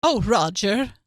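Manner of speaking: put-on drawn-out old-movie Mid-Atlantic call; Mid-Atlantic accent. Standing in for the clips, theatrical Mid-Atlantic accent. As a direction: sigh to Roger in film-star Mid-Atlantic.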